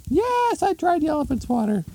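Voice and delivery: very high falsetto retort; hammed-up shrieky wife; exasperated wife. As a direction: fire it back in an exasperated squeal. Exasperated wife answers husband.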